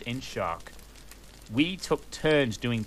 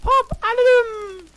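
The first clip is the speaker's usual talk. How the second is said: very high, loud and slow falsetto shriek; over-the-top shrieked spell; mock angry spell; 10 dB louder, slower than usual. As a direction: hurl the spell like a shrieking cartoon witch. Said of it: "I yielded angrily".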